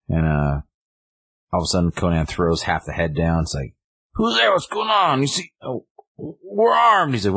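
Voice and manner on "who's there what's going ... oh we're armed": very high-pitched, hammed-up panicky poacher; comic high voice of a startled henchman; mock alarm. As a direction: squeal a challenge into the unknown. Startled poachers confront an unseen threat.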